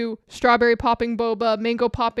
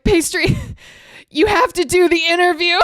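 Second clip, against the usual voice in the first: laughing plea; 5 semitones higher, 7 dB louder than usual. Retold or imitated. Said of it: "I'm like".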